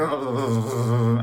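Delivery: loud juddering vocal rattle; imitated jolting. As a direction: imitate being shaken over cobblestones. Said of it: "feels like you go".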